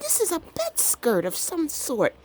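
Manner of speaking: high, squeaky guess; high-pitched voice. Retold or imitated. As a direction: puzzle it out in a squeal. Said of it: "I got my high-pitched voice going on".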